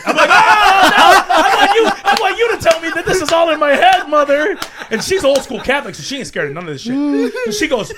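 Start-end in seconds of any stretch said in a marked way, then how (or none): 0.3-1.3 high, loud laughing "oh no"; mock scared cry. Telling mom about the ghost, a loud laughing mock cry. 1.3-4.6 high and loud, pleading through laughter; laughing mock plea. Begging his mother to say it's imagined, laughing plea.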